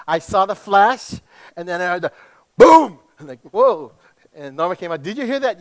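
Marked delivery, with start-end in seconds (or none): 0.1-3.0 building to loud boom. Retold or imitated. Preacher to congregation, imitating fireworks with a loud boom. 3.5-3.9 mock startled. Reacting to the boom. 5.0-5.6 raised high, lightly voiced wife asking; a hint of a startled wife. Preacher to congregation, lightly voicing wife Norma, startled.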